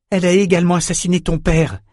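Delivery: quick, high-pitched argument; urgent insistence. A narrator's reading. Callan tries to convince the enraged girl, quick urgent insistence.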